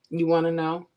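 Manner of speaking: ordinary talk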